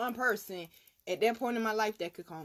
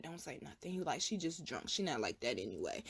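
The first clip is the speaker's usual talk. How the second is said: soft, low hushed warning; 6 semitones lower, 8 dB quieter than usual. Retold or imitated. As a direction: hush her quietly.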